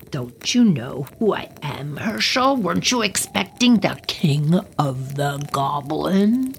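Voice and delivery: menacing breathy whisper; whispered goblin king. Acted. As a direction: taunt him in a whisper. Goblin king speaks in story.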